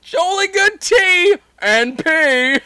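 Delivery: put-on very high slow British accent; mock British Cornholio. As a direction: squeal it slowly in a cartoon British accent. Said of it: "her accent".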